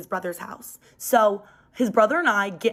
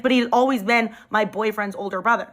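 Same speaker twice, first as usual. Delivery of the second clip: normal talking voice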